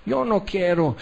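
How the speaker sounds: disdainful whine. Retold or imitated. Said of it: "muchos dicen".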